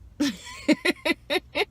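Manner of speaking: high-pitched run of giggles; loud giggle. Real, not acted. Teasing a broke quiz character, a high giggle. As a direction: burst into high, pulsing laughter. Laughing at her own joke.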